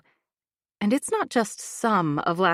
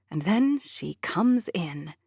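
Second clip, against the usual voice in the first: ordinary delivery, nothing marked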